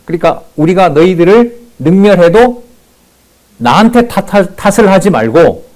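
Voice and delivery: high, loud sneering jeer at the defeated; mocking taunt. Preacher voicing Rabshakeh mocking Judah's faith, loud high sneer. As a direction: taunt them as the arrogant envoy. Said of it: "희롱입니다".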